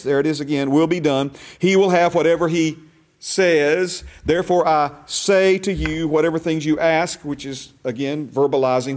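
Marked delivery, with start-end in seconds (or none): 0.7-7.2 will and says pushed louder, says rising; emphatic reading that stresses will and says. Shown as a demonstration. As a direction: lean hard on will and says. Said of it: "use inflection in my voice".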